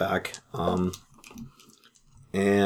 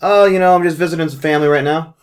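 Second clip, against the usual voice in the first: casual phone voice; 9 semitones higher, 3 dB louder than usual. Acted. Player voices his character on phone.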